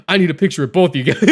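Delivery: popping off. Retold or imitated. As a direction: imitate an eager fan asking. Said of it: "So he was popping off".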